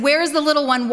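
urgent demand. Retold or imitated. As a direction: demand an answer, voice rising.

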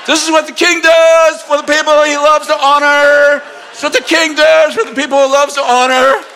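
high, loud town-crier shout; proclaiming shout. Retold or imitated. Haman parading Mordecai through town, shouting the proclamation. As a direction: bellow it through the streets like a herald.

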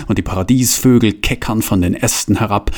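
ordinary delivery, nothing marked

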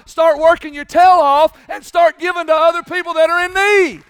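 very loud, high, slow shouted sermon; shouting preacher. Acted. Pastor roaring at thieves to get a job. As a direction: roar it like a revival preacher.

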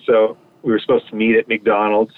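ordinary delivery

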